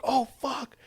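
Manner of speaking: high yelped alarm; a retold high panicked yelp; panicked curse. Panicked men in falling plane.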